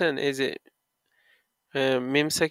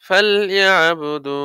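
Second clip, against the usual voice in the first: ফালইয়াবুদু slow and loud, last "du" held; loud stretched recitation; 3 semitones higher, 6 dB louder, much slower than usual. Shown as a demonstration. Teacher reciting the verse for students, loud, slow, stretched. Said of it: "এক আলিফ মাদে আসলি".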